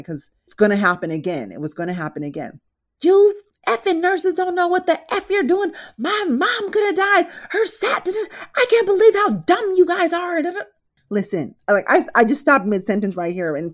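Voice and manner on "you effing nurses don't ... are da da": high, loud furious yell. Retold or imitated. Angry son yelling at the nurse, high furious shout. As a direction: scream the accusation at the nurses.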